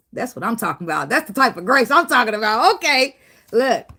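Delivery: ordinary delivery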